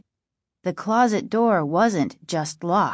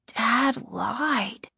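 put-on slightly hushed, higher young voice; subdued disbelief; 4 semitones higher, 4 dB quieter than usual. A narrator's reading. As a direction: let the betrayal sink in softly. Boy repeats the shocking discovery.

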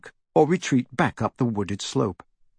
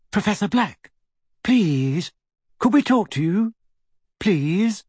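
pleading; 8 semitones higher than usual. A narrator's reading. Pleading with portrait.

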